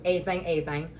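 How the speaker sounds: "A thing, a thing" said as "ay thang". Retold or imitated. Telling listeners what to stream, "A thing" as "ay thang". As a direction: drop the middle of "everything" to make "A thing". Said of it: "like she said".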